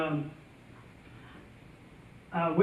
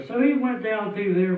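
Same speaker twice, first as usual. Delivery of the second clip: normal talking voice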